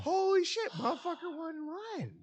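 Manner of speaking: high-pitched shocked exclamation; stunned amazement. Retold or imitated. Boss realizing the kid told the truth, shocked. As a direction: shriek it out in disbelief. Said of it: "I'm like".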